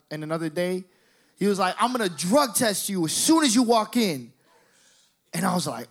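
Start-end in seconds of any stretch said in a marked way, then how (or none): 1.8-4.3 loud, raised-pitch warning; retold threat. Cousin threatening a drug test on arrival, loud indignant warning. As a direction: lay down the threat with hard emphasis.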